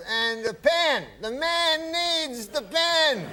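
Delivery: high, very slow Cosby drawl; Bill Cosby impression. Guest doing Cosby for the host, high and slow. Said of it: "so he goes".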